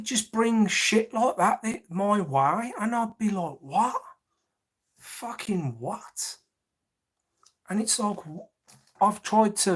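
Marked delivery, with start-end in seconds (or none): 3.6-6.4 disbelieving what. Retold or imitated. Recalls disbelief at brother's claim.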